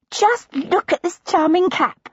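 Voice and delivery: put-on high, bright girlish gushing; eager younger sister. Younger sister bursting in with a pattern book, high and gushing. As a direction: gush over the pattern book.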